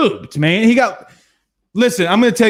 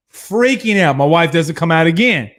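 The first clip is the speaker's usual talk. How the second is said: ordinary talk, nothing marked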